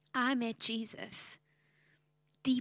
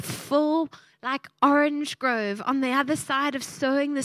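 louder, building preacher's cry; preaching at full force; 5 dB louder than usual. Acted. Preacher rousing the congregation, louder and building. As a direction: proclaim the harvest to the congregation.

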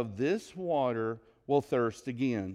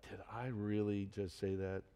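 self-reproach; 6 semitones lower, 11 dB quieter than usual. Retold or imitated. Listener recalling own blunder.

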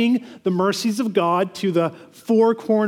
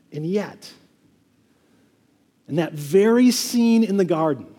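ordinary talk, nothing marked

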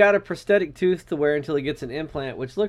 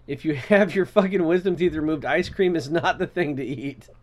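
ordinary delivery

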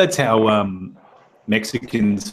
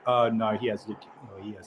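suddenly much softer, lower; abashed realisation; 3 semitones lower, 11 dB quieter, much faster than usual. Acted. People realising the kid has leukemia, mock abashed hush. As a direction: drop to an embarrassed undertone, acted.

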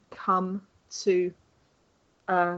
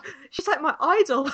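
starstruck junior; 6 semitones higher, 3 dB louder than usual. Retold or imitated. Imitating a starstruck junior rider.

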